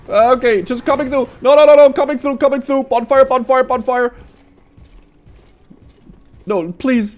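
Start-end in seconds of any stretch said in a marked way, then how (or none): 0.1-4.1 coming through shout. Real, not acted. Rushing past enemies.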